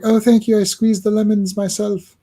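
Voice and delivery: high, bright hostess voice; sweet woman voice. Retold or imitated. Voicing woman in comic.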